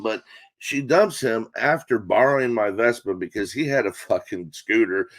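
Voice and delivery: ordinary talk, nothing marked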